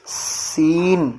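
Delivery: سین with a long hissing whistle on the s; whistling seen. Shown as a demonstration. Teacher showing how سین should whistle. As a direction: let سین whistle through the teeth, slowly. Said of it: "سین میں سیٹی کی آواز آتی ہے".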